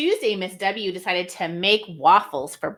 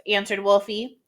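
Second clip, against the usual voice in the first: ordinary delivery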